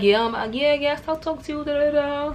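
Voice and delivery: high, lightly voiced fake agreement; fake sweet lie. Lying to the jailed boyfriend through the wall, fake sweet. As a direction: sound sweet while you're lying. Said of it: "I'm just lying to him".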